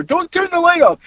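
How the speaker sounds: high-pitched mock protest; retold husband's protest. Husband telling wife off, mildly hammed-up protest. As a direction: imitate a yelled "Don't turn the light off". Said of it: "They heard him tell her".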